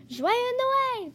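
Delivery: cheery Christmas greeting called out; a lively storybook holler; calling out. Acted. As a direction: call it merrily at the door. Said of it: "cria Lapin Coquin".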